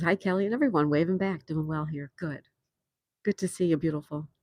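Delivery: normal talking voice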